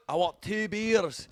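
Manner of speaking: high, slow Scottish promo voice; mock Scottish accent. Scottish Stone Cold ordering two beers, mock Scottish accent. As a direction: stretch it out as a hammed-up Scot.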